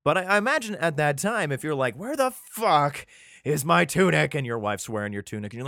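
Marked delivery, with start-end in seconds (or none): 2.0-4.4 higher, hammed-up outrage, stretched slowly; mock angry demand. Husband searching for his missing tunic, mock angry and slow. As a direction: play the fed-up husband who can't find his tunic.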